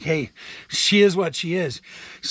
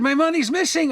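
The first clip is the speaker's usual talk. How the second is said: retold angry outburst; 9 semitones higher than usual. Caller telling the agent, indignant and raised. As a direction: throw it at her, exasperated.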